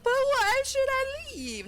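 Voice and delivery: very high, slow sulky why; mock pouting whine. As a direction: fake frown and whine it. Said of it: "fake frown and be like".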